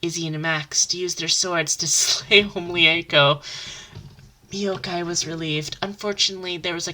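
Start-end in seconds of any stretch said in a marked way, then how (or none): none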